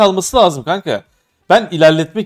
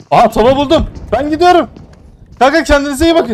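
excited; 9 semitones higher, 3 dB louder than usual. Real, not acted. Excited find, leaving teammates.

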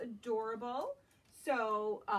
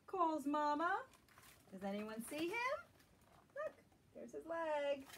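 gentle, lilting storybook read-aloud; reading to children; 3 semitones higher than usual. Acted. Teacher reading a picture book to young children, lilting. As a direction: draw little listeners into the story.

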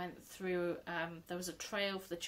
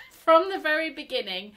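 laughing; 8 semitones higher, 13 dB louder than usual. Real, not acted. Laughing while praising a subscriber.

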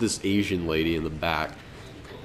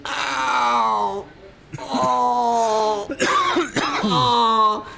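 much higher, louder mock cries of pain; hammed-up groaning; 14 semitones higher, 3 dB louder, much slower than usual. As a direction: overact the injury like you're dying. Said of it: "groaning and going".

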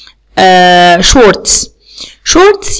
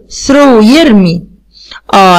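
normal talking voice

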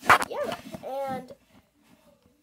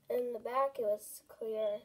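usual speaking voice

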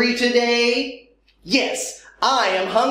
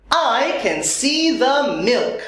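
ordinary delivery

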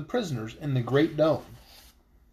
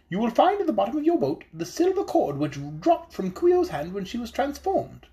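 high, small voice of a wise little fish; high fairy-tale fish voice, earnest; magical fish voice; 5 semitones higher than usual. Acted. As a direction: speak up as a tiny enchanted fish, solemn and hopeful. Goldfish gives instructions.

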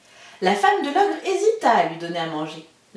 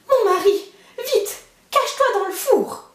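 much higher, urgent hushed alarm; panicked giantess; 11 semitones higher than usual. Acted. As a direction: hurry him into the oven. Wife urges boy to hide.

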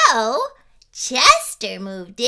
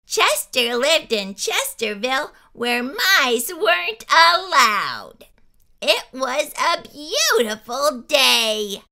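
plain reading voice

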